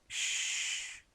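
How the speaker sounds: drawn-out voiceless "shhh"; soft, sustained sibilant; long hiss. Shown as a demonstration. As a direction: hiss the s sound softly. Demonstrates the hissing s sound.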